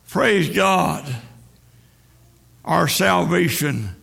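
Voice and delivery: ordinary talk, nothing marked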